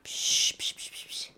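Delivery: soft hushing psch sounds; breathy psst to lure someone close. Acted. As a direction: call her over with quiet psch sounds. Calling someone over softly.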